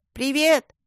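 изображённый высокий оклик «Привет!»; крикни приветствие издалека, не слишком громко; крик во всю глотку. A narrator's reading. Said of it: "во всю глотку крикнул". (высокий мальчишеский зов)

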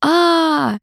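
high open-throated shout of joy; joyful yell. A narrator's reading. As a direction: yell out in pure delight. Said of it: "я заорал во всё горло".

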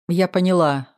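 ordinary delivery

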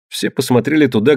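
ordinary delivery, nothing marked

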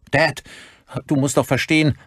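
high-pitched son's plea; urgent, high appeal; urgent pleading. A narrator's reading. Son pleads with his father.

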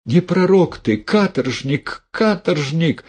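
high-pitched furious outcry; lady wailing in fury; enraged roar. A narrator's reading. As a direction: hurl the accusation high, drawing out the insult. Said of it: "взревела барыня".

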